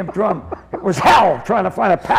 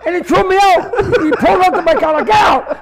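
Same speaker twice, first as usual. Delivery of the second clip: high, loud comic outrage; hammed-up indignant retelling; worked-up telling; 9 semitones higher, 6 dB louder than usual. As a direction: shout the punch line over the laughter. Tells ejection, building on laughter.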